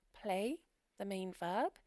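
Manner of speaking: normal talking voice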